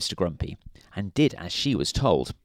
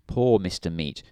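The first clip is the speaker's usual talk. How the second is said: ordinary delivery, nothing marked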